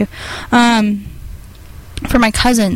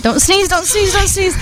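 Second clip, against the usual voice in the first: squealing don't sneeze; high, urgent and panicky; high urgent pleading; 9 semitones higher than usual. Real, not acted. Pleading friend not to sneeze.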